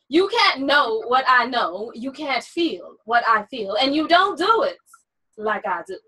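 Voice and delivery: slow, measured poem reading; poetry reading. Acted. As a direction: read it like a spoken-word poem. Reads a poem excerpt aloud.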